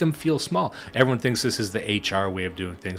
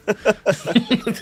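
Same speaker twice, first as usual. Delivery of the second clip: high laugh; 12 semitones higher, 5 dB louder than usual. Real, not acted. Laughs at own rant.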